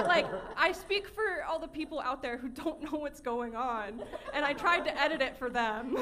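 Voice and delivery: laughing while talking. Real, not acted. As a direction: talk through giggles. Laughing at her own joke.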